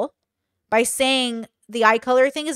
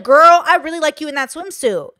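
higher, louder, bright bestie praise; friendly girl compliment; 4 semitones higher, 8 dB louder than usual. Shown as a demonstration. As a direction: gush it like hyping up a friend. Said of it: "more like a safety communication".

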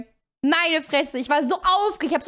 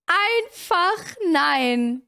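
slower resigned no; much slower than usual. Real, not acted.

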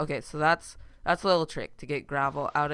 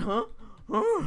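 dog imitation; 12 semitones higher than usual. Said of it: "He just went".

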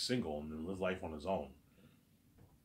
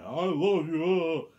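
high, loud wail imitating her crying; mock crying; 9 semitones higher, 11 dB louder, much slower than usual. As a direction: wail it out in a mocking sob. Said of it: "she cries every night".

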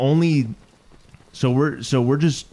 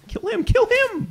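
high-pitched frantic yelp; frantic urging. Real, not acted. Player urging partner to attack the enemy, frantic. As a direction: urge them on in a high panicky voice.

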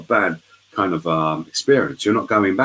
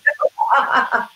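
laugh. Real, not acted. Laughing at ant story.

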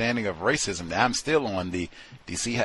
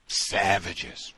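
ordinary talk

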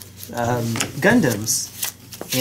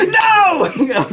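high squealing laugh-shout; 5 dB louder than usual. Real, not acted. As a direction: shriek it through a laugh. Laughing outcry at opponent's play.

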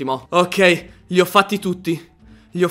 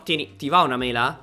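ordinary delivery

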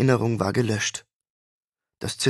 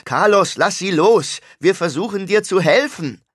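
higher, louder alarmed cry; alarmed call; 8 semitones higher, 6 dB louder than usual. A narrator's reading. Lucius cries out as the patient grips his wife, alarmed. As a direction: shout in panic for his wife. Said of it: "rief Lucius".

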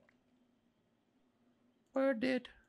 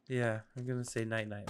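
ordinary delivery

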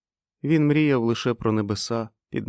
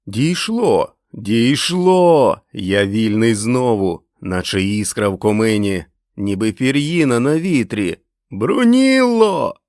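loud, elated exclaiming; triumphant shouting, stretched words; jubilant outcry; 3 semitones higher, 7 dB louder, slower than usual. Acted. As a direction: cry out a joyful discovery, building to a call. Einar rejoices, calls his wife.

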